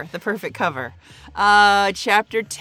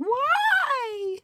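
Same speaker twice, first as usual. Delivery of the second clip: whiny high why. Retold or imitated. Voicing upset Audrey.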